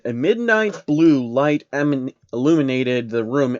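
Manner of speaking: ordinary delivery, nothing marked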